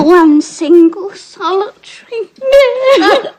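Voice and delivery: laughing and sobbing at once; tearful laugh-cry over a bad marriage. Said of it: "I haven't cried since I was fourteen".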